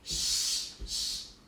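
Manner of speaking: two soft unvoiced S hisses; hissed s sounds. Shown as a demonstration. Teacher pulling the S out of sensation. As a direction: hiss "S, s" very softly. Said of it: "You have it three times actually".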